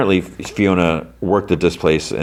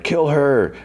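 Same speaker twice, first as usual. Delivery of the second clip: mock scream; 9 semitones higher than usual. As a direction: imitate a screamed order without the volume. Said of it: "like, scream".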